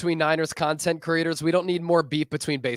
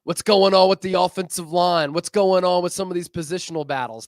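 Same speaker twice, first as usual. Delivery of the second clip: high, loud mock fan calling out questions; eager fan; 4 semitones higher, 6 dB louder than usual. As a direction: play an excited fan cornering him at the field. Imagined fans pestering at games.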